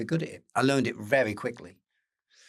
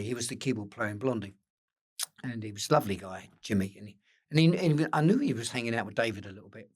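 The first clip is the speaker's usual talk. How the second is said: ordinary talk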